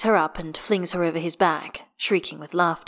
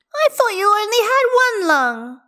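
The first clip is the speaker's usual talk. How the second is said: put-on high, loud child's plaint; little girl's voice; 8 dB louder than usual. A narrator's reading. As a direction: pipe up like a puzzled child. Child answers her father, sad.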